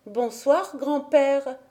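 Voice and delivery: a small girl greeting her grandfather, lightly voiced; little girl's greeting. A narrator's reading. Heidi greets her grandfather, a lightly voiced little girl. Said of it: "lui tendit la main en disant".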